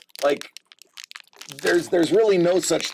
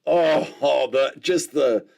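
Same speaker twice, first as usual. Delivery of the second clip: usual speaking voice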